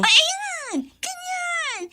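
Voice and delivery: high, gleeful ayan, ganyan; triumphant squeal. Acted. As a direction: crow in delight at her answer. Triumphant approval of friend. (matinis at masayang pagsang-ayon)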